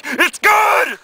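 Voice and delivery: high, loud exclamation; dad yelling. Acted. Dad approves after eating the whole cake, yelling. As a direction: holler it way up high.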